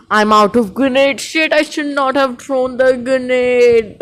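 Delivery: high, slow drawn-out lament; anguished regret. Real, not acted. Gamer out of grenades, anguished wail of regret. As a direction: wail over the mistake.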